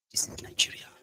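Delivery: breathy whispered aside. Real, not acted. Telling viewers the truth, a whisper. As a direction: drop to a secret whisper. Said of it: "whispered aside".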